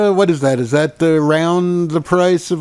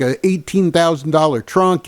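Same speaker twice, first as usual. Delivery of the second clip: ordinary talk, nothing marked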